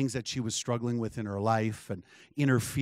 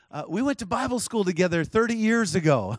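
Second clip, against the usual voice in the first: higher-pitched retold exclamation; retold eager recognition; 6 semitones higher than usual. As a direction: quote her lifting the pitch, pleased to recognize you. Retold woman announcing old acquaintance.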